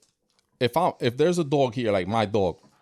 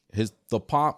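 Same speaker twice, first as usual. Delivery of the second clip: usual speaking voice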